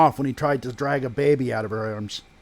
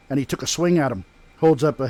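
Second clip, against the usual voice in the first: usual speaking voice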